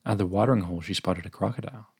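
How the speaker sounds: plain reading voice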